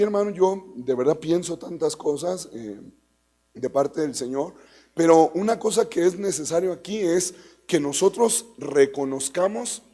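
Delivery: ordinary talk, nothing marked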